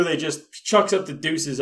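usual speaking voice

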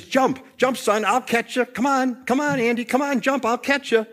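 high, insistent father calling up; acted urgent plea; urging father. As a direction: coax a child to jump, pitched high. Acts father urging son to jump.

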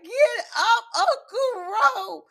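high-pitched exaggerated wail; mock bawling. Viewer bawling at the screen over Unc, hammed-up crying. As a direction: sob it out, dragging the last words.

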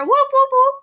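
high, loud hype whoops; playful siren-like whooping; whooping cheer. Pretend. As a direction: whoop like a crowd shout-out. Mock crowd cheer after fan callout.

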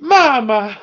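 high shrieking "Mama!" cry, laughing through it; overacted anime dub. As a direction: wail "Mama!" high and loud like a cheesy dub. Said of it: "that's his real voice ... the dub voice".

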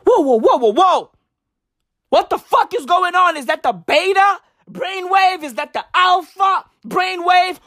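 high-pitched, hammed-up bewilderment; incredulous questions, voice pitched high; mock alarm. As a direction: replay a freaked-out reaction, pitch jumping up. Acts out alarm at the brainwaves.

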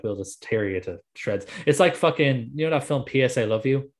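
ordinary talk, nothing marked

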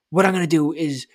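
normal talking voice

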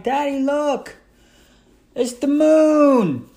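put-on high, slow toddler voice pointing at the sky; little kid voice. Toddler son showing his dad the moon, high and slow. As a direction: play the two-year-old, wide-eyed and slow.

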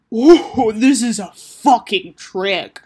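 loud, drawn-out "Oh", slow indignation; exasperated realization. Acted. Taco catching on to sister's dare, exasperated. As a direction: burst out as if tricked. Caught out in sibling quarrel.

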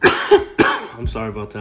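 loud cough then slow apology; coughing fit. Real, not acted. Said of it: "I know it's awful to hear".

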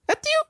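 tiny, very high-pitched achoo; dainty fake sneeze. Mocking how girls sneeze, dainty fake sneeze. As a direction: squeak out an exaggerated girly sneeze. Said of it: "when girls be sneezing like".

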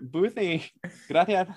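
Spanish th in Boothie and Gracias; mock Castilian accent. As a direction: lisp Gracias as a playful Spain accent. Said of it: "As they say in España".

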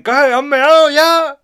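high, loud, slow taunting bouncer voice; mocking bouncer. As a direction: drag it out like a cocky doorman teasing. Bouncer greeting him outside.

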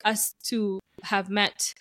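ordinary talk

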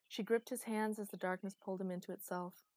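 plain reading voice

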